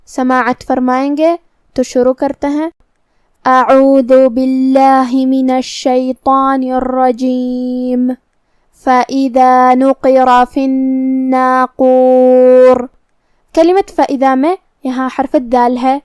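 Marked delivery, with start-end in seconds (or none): 3.5-8.1 slow, drawn-out chanted tilawat; Quran recitation. Acted. Teacher opening the lesson for her students, slow chanted recitation. 8.8-12.9 slow melodic tilawat, each word stretched; Quran recitation. Acted. Teacher reciting the verse to her class, slow melodic chant.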